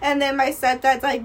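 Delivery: ordinary talk, nothing marked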